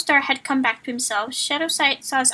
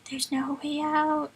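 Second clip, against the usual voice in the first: despairing whisper; 2 semitones higher, 6 dB quieter, slower than usual. Acted.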